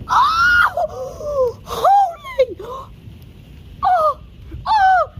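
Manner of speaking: high gasping cries of shock; flinching at every flash; real gasps and high yelps; startled gasps and yelps. Real, not acted. Gasping at strikes overhead.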